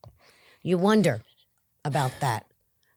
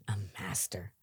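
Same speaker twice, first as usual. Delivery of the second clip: a soft, breathless whisper, much lower and quieter; whispered echo; 7 semitones lower, 12 dB quieter than usual. Real, not acted. Recovering addict repeating his past to the host, whispering. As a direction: let it drop to a whisper as if ashamed.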